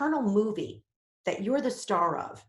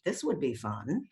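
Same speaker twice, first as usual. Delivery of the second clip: suggestive; 5 semitones lower, much slower than usual. Retold or imitated. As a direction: muse with a sly idea. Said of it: "your mind starts thinking about".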